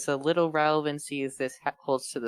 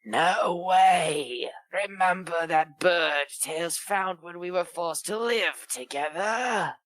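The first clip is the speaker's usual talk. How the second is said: manic voice; 3 semitones higher, slower than usual. Acted. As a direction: play the manic hedgehog, cocky and excitable. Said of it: "I need to make a manic voice now".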